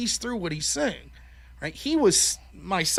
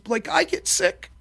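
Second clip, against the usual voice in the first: upset little son; 9 semitones higher, 4 dB louder than usual. Retold or imitated. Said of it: "then he starts crying".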